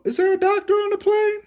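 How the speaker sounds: very high, mock-dramatic movie call; movie announcer call. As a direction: sing out the line like the old Airplane movie. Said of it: "they go".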